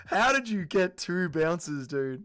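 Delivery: ordinary delivery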